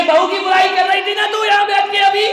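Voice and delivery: loud, high rebuke; scolding shout. Retold or imitated. Monk shouts at the gossiping mother-in-law, scolding. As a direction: bark it at her like a scolding monk.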